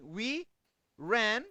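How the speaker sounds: isolated words with long gaps between; word-by-word example. Shown as a demonstration. Teacher showing a separated phrasal verb, slowly, word by word. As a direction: lay out the sentence piece by piece.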